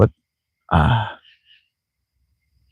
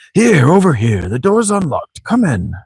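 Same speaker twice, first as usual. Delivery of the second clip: high, sing-song character voice; theatrical high-pitched welcome; character calling over; 10 semitones higher, slower than usual. Acted. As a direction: beckon them in with swooping pitch. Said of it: "figure out a voice I want for him".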